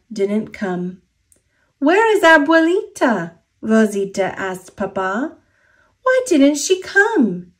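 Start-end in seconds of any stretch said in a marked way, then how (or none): 1.8-3.3 put-on high, eager child asking; high child's voice, longing; young girl's voice. Rosita asks Papa, child. 6.0-7.5 put-on high, disappointed child; high child's voice, let down; young girl's voice. Rosita asks Papa, child.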